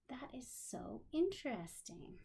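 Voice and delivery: hushed whisper of wonder; soft awed whisper. Real, not acted. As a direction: marvel at it in a whisper. Whispered aside to child.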